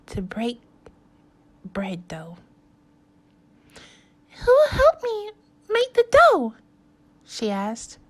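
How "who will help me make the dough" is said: high, loud hen asking. Acted. The hen asking friends to make dough. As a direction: ask like an eager little hen.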